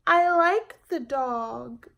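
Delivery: put-on high, loud and slow child's plea; a girl clinging to her find; stubborn child's voice; little girl, insistent. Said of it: "Jamaica answering mother".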